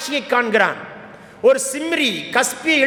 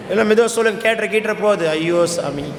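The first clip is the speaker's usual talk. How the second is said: mock caution; 5 semitones lower than usual. Real, not acted. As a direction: chide them with irony, dropping low at the end. Sarcastically chides quiet congregation.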